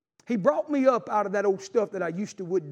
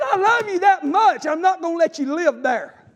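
God's loving shout; 8 semitones higher, 6 dB louder than usual. Retold or imitated. Preacher voicing God to his child, high loud shout. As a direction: shout God's promise with full heart. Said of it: "He said".

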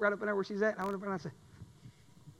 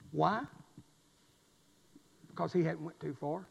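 ordinary delivery

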